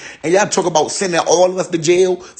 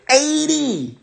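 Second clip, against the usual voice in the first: high, drawn-out "Eighty" in mock shock; stunned disbelief; 10 semitones higher than usual. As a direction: let "Eighty" fall off in disbelief. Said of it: "Listen how that sound".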